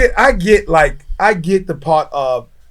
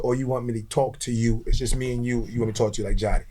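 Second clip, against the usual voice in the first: retold in a much lower, softer voice; low confidential aside; 8 semitones lower, 14 dB quieter, faster than usual. Man offering his boss straight talk, low confidential aside. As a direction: drop down low and quiet, just between two people. Retelling a frank private exchange.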